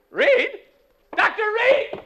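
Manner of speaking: high, loud panicked shout; alarmed cry. Acted. Announcer crying out in alarm when he sees the blood. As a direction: scream the name in alarm.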